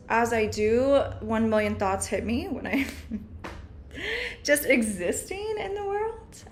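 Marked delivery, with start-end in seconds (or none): 3.7-6.4 high, slow laughing words. Real, not acted. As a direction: laugh through the line. Laughs at herself.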